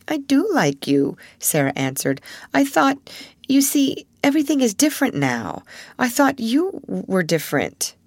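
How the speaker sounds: put-on high, earnest young girl. A narrator's reading. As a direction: explain yourself honestly, a little hurt. Sarah voiced, earnest.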